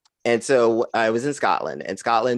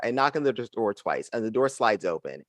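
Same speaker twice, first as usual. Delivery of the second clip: ordinary delivery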